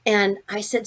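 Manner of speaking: plain reading voice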